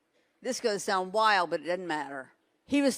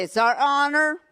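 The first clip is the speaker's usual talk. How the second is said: louder, higher declaration for the crowd to repeat; model declaration; 4 semitones higher, 5 dB louder than usual. Shown as a demonstration. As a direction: proclaim it boldly.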